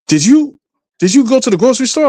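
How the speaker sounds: high, fast prying questions; suspicious mother. Retold or imitated. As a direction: imitate mom fishing for answers. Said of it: "she was like".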